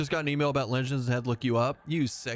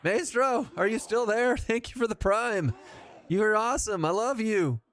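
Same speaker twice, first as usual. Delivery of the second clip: excited thanks; 7 semitones higher than usual. Real, not acted. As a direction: gush over the new subscriber. Streamer thanking a prime subscriber.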